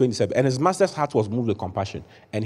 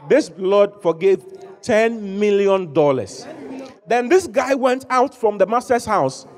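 high, rising, emphatic sermon delivery; fervent preaching; 7 semitones higher than usual. Acted. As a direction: preach it out to the whole church. Preaching full force.

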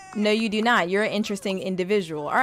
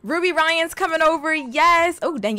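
ordinary talk, nothing marked

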